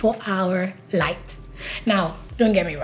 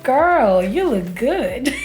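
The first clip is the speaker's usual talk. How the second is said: sassy self-praise; 4 semitones higher, much slower than usual. Retold or imitated. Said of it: "I look at myself in the mirror and I'm like".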